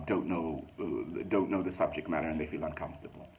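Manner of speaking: ordinary talk, nothing marked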